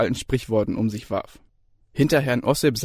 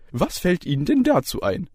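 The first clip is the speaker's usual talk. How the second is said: jovial lecturer; 7 semitones higher than usual. A narrator's reading. Lecturer opens the exam, cheery and high. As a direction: chirp it brightly. Ossip questions Daniel.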